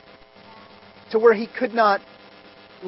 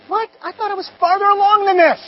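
a raised, dismayed cry of disbelief; high exasperated what; 10 semitones higher, 6 dB louder than usual. Retold or imitated. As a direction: let it jump up in frustrated surprise. Retold inner dismay.

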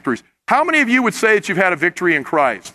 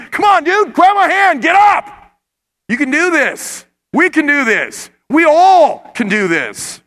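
loud, high rallying call; a buddy yelling encouragement from above; encouraging friend; 10 semitones higher, 5 dB louder, slower than usual. Acted. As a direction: shout to lift a friend up. Friend pulling you from muck.

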